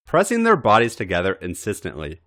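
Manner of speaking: ordinary delivery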